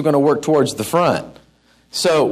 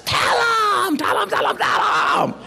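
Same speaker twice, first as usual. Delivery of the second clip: much higher urging mock scream fading to a whisper; your inner voice screaming to tell them; 13 semitones higher, slower than usual. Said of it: "the inside of you screams".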